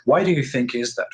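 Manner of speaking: ordinary delivery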